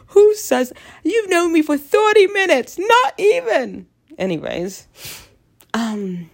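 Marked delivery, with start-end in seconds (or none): 0.1-3.9 much higher, louder, drawn out; mock outrage. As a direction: scold him theatrically. Ranting at imagined man.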